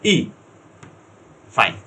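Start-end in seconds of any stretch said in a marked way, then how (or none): 0.0-0.3 isolated ই as a clear long ee; vowel demo. Shown as a demonstration. Teacher models ই as a long ee sound. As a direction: say ই alone as a long ee. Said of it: "এটা হচ্ছে".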